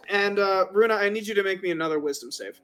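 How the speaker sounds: ordinary talk, nothing marked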